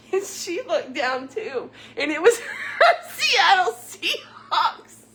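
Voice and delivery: slow words squeezed through laughter; barely getting it out through giggles; real laughing fit. Real, not acted. Still laughing at the story.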